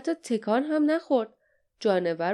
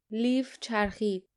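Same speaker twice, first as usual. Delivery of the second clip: ordinary delivery, nothing marked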